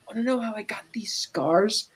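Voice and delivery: breathy menacing whisper; hushed villain's question; Joker whisper. Retold or imitated. Retelling Joker's menacing line.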